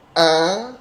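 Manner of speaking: A said with lips spread in a smile; smiling A. Shown as a demonstration. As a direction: say A with a slight smile. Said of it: "Ini agak senyum". (A diucapkan sambil agak tersenyum)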